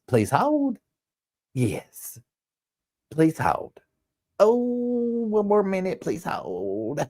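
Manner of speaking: high, lilting phone receptionist repeating please hold; high sing-song please hold. Retold or imitated. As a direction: chirp it over and over like an anxious receptionist. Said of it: "all I would hear across the hallway".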